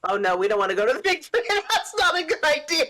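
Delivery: fast, laughter breaking in; laughing through words. Real, not acted. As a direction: crack up mid-sentence. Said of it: "did my D M voice give way there?".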